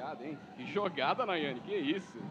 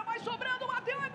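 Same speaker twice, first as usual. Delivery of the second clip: excited; 8 semitones higher than usual. Real, not acted. Goal call rising.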